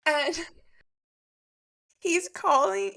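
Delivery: laughing while telling it. Real, not acted. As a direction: giggle through the words. Laughing telling phone story.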